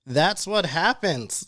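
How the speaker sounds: slow, dismissive shrug; heartless shrug. Retold or imitated. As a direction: say it coldly, unbothered. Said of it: "I was like".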